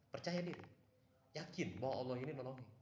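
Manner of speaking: high and loud, insisting; emphatic advice. Real, not acted. Urging listeners to stay confident against ghosts, loud and high. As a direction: drive the point home hard. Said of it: "Saya kasih tahu".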